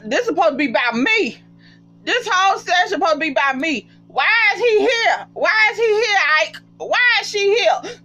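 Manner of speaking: lightly mocking, rising up high and whiny; imitating Jaylon. Vlogger mimicking Jaylon's jealous confession, a light high whine.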